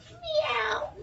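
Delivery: high meow; cat meow. Pretend. Tallulah pretending to be a cat, high meow. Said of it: "Tallulah meowed like a cat".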